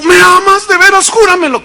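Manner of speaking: high insistent voice; demanding wife, voice up high; pleading wife. Acted. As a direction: beg him to swear it. Wife demands proof of love.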